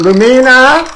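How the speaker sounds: high, loud call of a name. Real, not acted. Calling out to Lumina, loud and high. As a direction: yell across the room for her.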